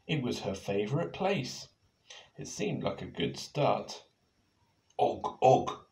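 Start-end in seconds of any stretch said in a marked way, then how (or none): 5.0-5.9 caveman grunt of agreement; louder og og; agreeing grunt. Acted. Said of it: "Og agreed".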